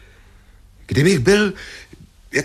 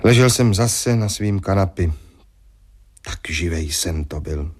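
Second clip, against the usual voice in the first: story narration; 5 semitones lower than usual. Acted. Inner narration over music.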